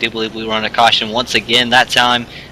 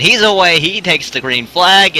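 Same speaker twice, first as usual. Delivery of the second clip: excited call; 6 semitones higher, slower than usual. Real, not acted. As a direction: call the restart with a jolt of energy. Leader takes green flag.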